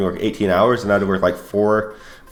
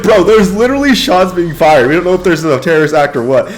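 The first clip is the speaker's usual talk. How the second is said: loud, raised incredulous pleading; exasperated insistence; 7 semitones higher, 5 dB louder than usual. Retold or imitated. Guard reasoning with stubborn colonel, exasperated and loud. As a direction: argue it out with pitch pushed up.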